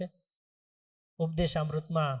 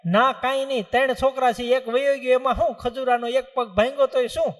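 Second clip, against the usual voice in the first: higher, louder careless shrug; dismissive old father; 6 semitones higher, 5 dB louder than usual. Acted. Father refusing to drink for his son, coldly dismissive. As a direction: wave it off, he has other sons.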